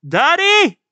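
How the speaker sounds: very high, loud shout; child's cry for daddy. Acted. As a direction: scream out for a parent. Acting child screaming for father.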